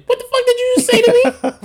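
loud, high, fast incredulous yell; snapped outburst. Retold or imitated. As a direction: retell it as an outraged inner explosion. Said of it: "my brain and my heart snapped".

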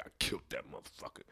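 hushed, breathy pride; a whispered killer's pride; whispered boast. Pretend. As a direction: whisper it like a proud secret. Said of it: "you'd be like".

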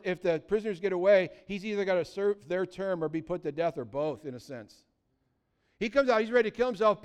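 ordinary delivery

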